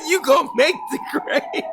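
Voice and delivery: laughing through the joke; high laugh, laughing words. Real, not acted. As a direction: burst into laughter.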